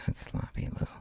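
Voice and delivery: breathy hushed rebuke to himself; 小声で自分を叱る; whispered scolding. Real, not acted.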